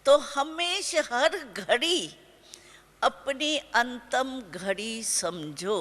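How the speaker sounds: slow, weighty, each word set apart: हर घड़ी अपनी अंतम घड़ी समझो; slow emphatic slogan. Shown as a demonstration. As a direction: drive the slogan home slowly. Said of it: "समझा?".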